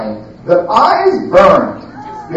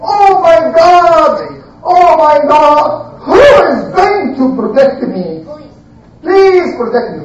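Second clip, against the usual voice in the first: high, loud, drawn-out cry for help; acted yelling; 8 semitones higher, 3 dB louder than usual. A narrator's reading. Prophet pushing the battered door, yelling for protection. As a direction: wail out a desperate plea. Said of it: "he's yelling and screaming and saying".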